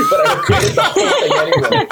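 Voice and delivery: high laughing confession. Real, not acted. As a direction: squeal it out through laughter. Laughing admission.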